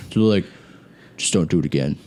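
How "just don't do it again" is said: low, restrained stern warning; stern staff warning. Retold or imitated. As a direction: lightly imitate a stern attendant, pitched down. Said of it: "looking at me all stern".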